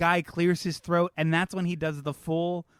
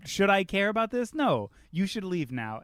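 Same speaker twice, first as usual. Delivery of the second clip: ordinary talk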